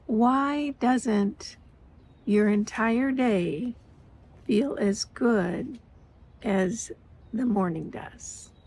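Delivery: slow, drawn-out question with long pauses; slow and deliberate. Retold or imitated. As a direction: let each phrase hang before the next. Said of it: "it finally hit me".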